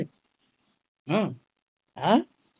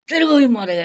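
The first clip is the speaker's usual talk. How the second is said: loud, higher mock threat as if waving a knife; 7 semitones higher, 8 dB louder, faster than usual. Teacher mimics patient threatening with a knife, loud mock threat. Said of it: "যে চাকু নিয়ে বলে না".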